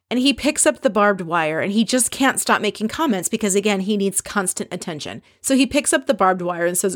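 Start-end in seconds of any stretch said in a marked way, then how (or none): none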